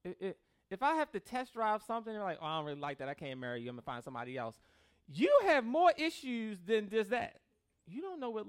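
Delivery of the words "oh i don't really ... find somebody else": low, casual brush-off; dismissive partner. Retold or imitated. Partner walking away after a test drive, low dismissive brush-off. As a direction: imitate a picky partner calling it off, lower.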